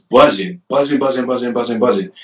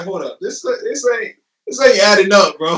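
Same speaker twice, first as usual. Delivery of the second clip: incredulous; 9 semitones higher than usual. Retold or imitated. Disbelief on meeting the photographer.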